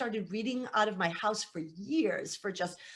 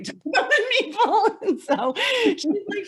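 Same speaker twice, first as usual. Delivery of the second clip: laughing; 10 semitones higher, 6 dB louder than usual. Real, not acted. Laughs recalling odd conversation.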